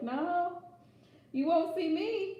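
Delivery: playful retort. Retold or imitated. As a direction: toss it back in a high singsong taunt. Playful retort to her partner.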